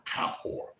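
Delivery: "Kippur" whispered, voiceless and soft. Shown as a demonstration. Preacher repeating the word Kippur, whispered.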